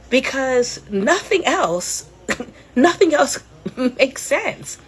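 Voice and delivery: slow, laughing through the words. Real, not acted. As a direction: giggle out the punchline slowly. Laughing at Todd scheme joke.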